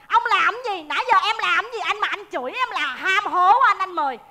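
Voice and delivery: ordinary talk, nothing marked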